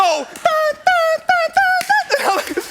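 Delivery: high, drawn-out "dun" beats sung loudly; mimicked drift music. Storyteller imitating Tokyo Drift music during his U-turn, mimicked music. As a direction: belt out action-movie theme notes, high.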